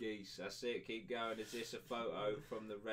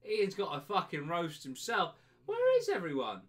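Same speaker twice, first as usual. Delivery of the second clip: calling out; 6 semitones higher, 9 dB louder than usual. Real, not acted. Calling out to empty room.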